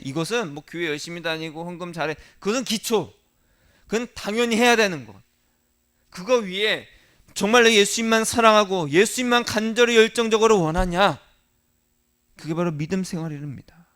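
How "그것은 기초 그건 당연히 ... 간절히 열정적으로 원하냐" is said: loud, higher-pitched, emphatic sermon delivery; forceful preaching. Real, not acted. Preacher challenges congregation to want only Jesus, forceful preaching. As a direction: drive it home to the congregation, loud and high.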